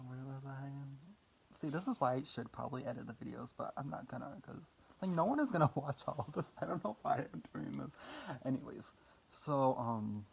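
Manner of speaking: ordinary talk, nothing marked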